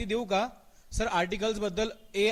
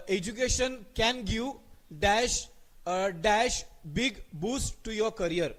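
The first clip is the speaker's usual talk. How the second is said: very slow, word by word dictation; dictating the sentence slowly; much slower than usual. Shown as a demonstration. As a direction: read it out slowly for students to copy. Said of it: "slow dictation of sentence".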